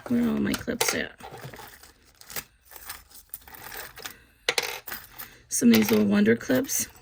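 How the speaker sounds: slightly out of breath; audible heavy breathing between words; breathing hard. Real, not acted. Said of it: "Sorry if I'm breathing hard".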